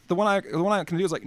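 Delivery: ordinary talk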